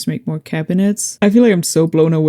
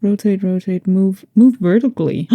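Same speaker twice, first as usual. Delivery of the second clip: normal talking voice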